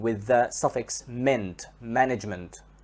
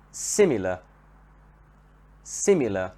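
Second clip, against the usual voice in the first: "similar" with SIM stressed, falling away; stress at the beginning; 4 semitones lower than usual. Shown as a demonstration. Teacher shows learners the adjective, first syllable of "similar" stressed. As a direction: lean on the start of "similar": SIM-ilar. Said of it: "here the stress is at the beginning".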